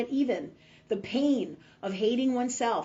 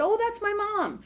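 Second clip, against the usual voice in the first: much higher, light retold answer; breezy innocent reply; 12 semitones higher than usual. Telling a healer who is in the photo, high breezy reply. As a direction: pitch it way up, innocently.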